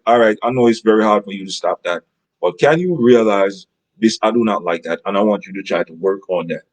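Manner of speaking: calm reasonable voice to a partner; calm not screaming. Pretend. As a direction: lay it out gently, no yelling. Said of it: "I'm not gonna scream".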